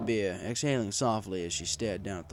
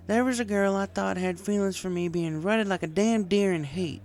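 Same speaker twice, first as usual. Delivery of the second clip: higher country mare voice, slurring a touch; Applejack voiced as drunk farm mare; 11 semitones higher, 4 dB louder than usual. A narrator's reading. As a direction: play the tipsy farm girl venting.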